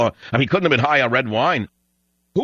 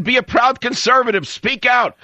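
much higher, louder shouting; retold yell; 12 semitones higher, 3 dB louder than usual. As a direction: yell it across the store to the clerk. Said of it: "I yelled out in the loudest voice".

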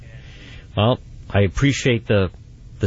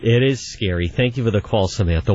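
ordinary delivery

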